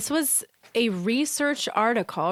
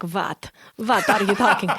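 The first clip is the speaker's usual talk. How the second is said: put-on playful thick Russian accent. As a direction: warm up into Russian with V for W. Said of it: "this kind of Russian accent".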